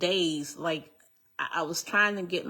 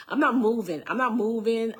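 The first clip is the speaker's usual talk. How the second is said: louder, higher, insistent repetition; retold firm refusal; 5 semitones higher, 6 dB louder than usual. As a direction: dig in, voice up in pitch and volume.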